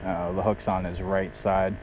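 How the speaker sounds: ordinary delivery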